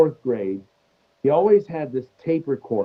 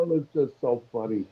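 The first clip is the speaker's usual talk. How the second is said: usual speaking voice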